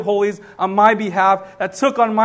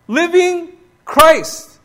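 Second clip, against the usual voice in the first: slow "Living Christ", both words high and loud; verbless emphatic reading; 8 semitones higher, 5 dB louder, much slower than usual. Shown as a demonstration. Preacher drives home the verbless phrase, emphatic, "Living... Christ" slow and loud. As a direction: stretch "Living", then land hard on "Christ". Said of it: "there's no verb in the original language".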